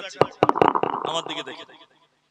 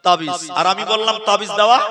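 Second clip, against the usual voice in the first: normal talking voice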